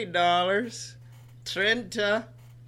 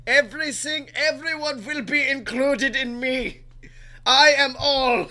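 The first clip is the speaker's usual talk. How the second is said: higher, louder demonic legion; ominous hive voice; 5 semitones higher, 6 dB louder than usual. Acted. To listeners, a loud ominous legion voice. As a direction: boom it like a many-voiced entity. Man of many voices boast.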